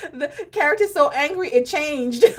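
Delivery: high, laughing, nearly crying words; real laughter breaking in; laughing while explaining. Real, not acted. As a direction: talk through the giggles.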